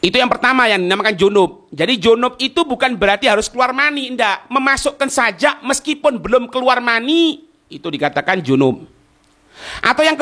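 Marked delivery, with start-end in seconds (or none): none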